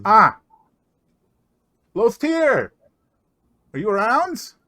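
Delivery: high, loud call across the room. Acted. Dwarf calls loudly for his missing companion. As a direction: shout for someone out of sight.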